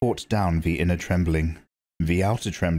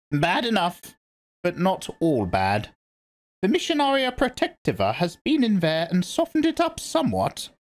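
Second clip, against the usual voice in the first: put-on raised, measured voice of a stern old matriarch; grave old priestess speaking; stern old Reverend Mother; 11 semitones higher than usual. A narrator's reading. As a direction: lecture her like a severe elder. Old woman replies.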